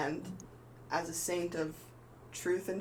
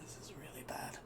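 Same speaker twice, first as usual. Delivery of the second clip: whispered, much softer dread; grim whisper; 12 dB quieter than usual. Acted. Ethan reacts to the unprecedented danger, grim whisper. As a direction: barely breathe the words.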